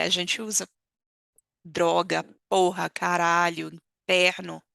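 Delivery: ordinary delivery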